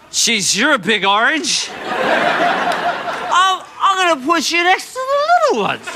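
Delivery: high, silly tipsy voice; tipsy store packer. Retold or imitated. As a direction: talk to the fruit like a drunk. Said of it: "store packer ... getting into the grog".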